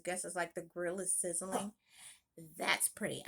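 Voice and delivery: usual speaking voice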